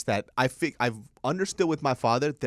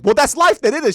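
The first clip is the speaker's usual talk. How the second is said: mock loud retort; 14 semitones higher, 14 dB louder than usual. Arguing with mom.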